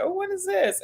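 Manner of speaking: mock amazement at the gift; delighted surprise. First tasting the Harry and David gift at the office, amazed. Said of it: "I was like".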